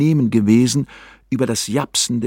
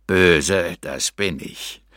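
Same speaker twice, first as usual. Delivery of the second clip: lower, slow demonic voice; infernal voice; 4 semitones lower, much slower than usual. Pretend. Narrator posing as a demon to the hermit, lower, slow infernal voice. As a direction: menace him like a demon from hell. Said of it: "in einem Tonfall, welcher wahrlich aus infernalischen Gefilden".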